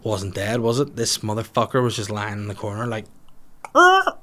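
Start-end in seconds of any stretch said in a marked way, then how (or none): 3.7-4.2 very high loud imitated pigeon cry; pigeon squawk. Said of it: "That's the noise a pigeon makes".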